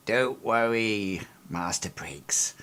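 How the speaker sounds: put-on slow, soft-spoken old lady voice, some words whispered; sly reassurance. Old Lady Miko leaning toward Briggs, slow and hushed. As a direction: lean in and murmur it slowly.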